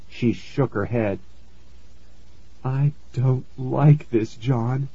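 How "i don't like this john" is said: soft, slow, worried mother on the edge of tears; tearful mom. A narrator's reading. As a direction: say it slowly and quietly, upset. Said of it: "Mom had tears in her eyes".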